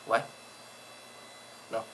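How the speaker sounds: ordinary delivery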